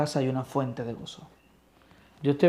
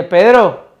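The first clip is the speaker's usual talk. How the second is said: calling out; 4 semitones higher, 13 dB louder than usual. Acted. Christ calls Peter a second time.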